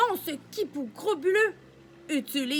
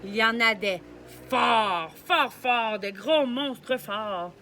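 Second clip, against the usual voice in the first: big strong voice; 5 semitones lower, slower than usual. Acted. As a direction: boom it out like a strong brute. Strong monsters.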